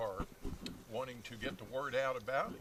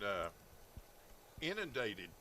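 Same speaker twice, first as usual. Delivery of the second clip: usual speaking voice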